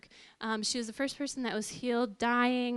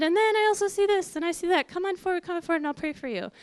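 higher, louder preacher calling people forward; excited preacher; 7 semitones higher, 5 dB louder, faster than usual. Retold or imitated. Preacher calling the couple forward, higher and louder. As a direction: hype it up like a preacher at the altar call.

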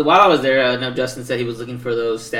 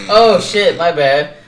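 louder, higher jolt of apology; retold sudden realisation; 5 semitones higher, 10 dB louder, much slower than usual. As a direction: blurt it like you just caught your slip. Retelling his realisation of mistake.

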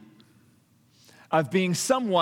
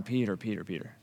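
tender rebuke; 10 semitones lower, 8 dB quieter, faster than usual. Acted. Jesus rescuing sinking Peter.

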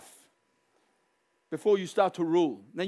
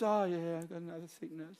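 mock resignation; 10 dB quieter than usual. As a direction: give in limply, voice dropping away. Acts passive acceptance of sickness.

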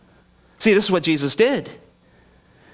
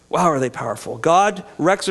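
ordinary talk

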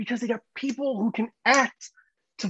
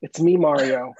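put-on low, unaccented catchphrase; deadpan Mario; 8 semitones lower, 4 dB louder than usual. Actor jumps out of a pipe, deadpan low catchphrase. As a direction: say it low, with no character voice at all. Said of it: "that's how he's gonna talk".

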